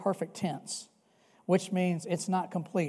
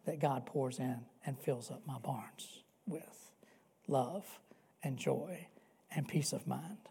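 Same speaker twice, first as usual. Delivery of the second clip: soft whispered list, much softer; whispered hush; 5 semitones lower, 11 dB quieter than usual. Real, not acted. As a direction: drop to a whisper for love, joy, peace. Preacher drops to a hush.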